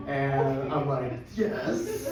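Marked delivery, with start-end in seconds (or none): 1.3-2.1 high, drawn-out yes with laughter; acted thrill, pitched way up; excited. Spotting the scrunchie man in the locker room, excited. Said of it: "he knows how excited I am".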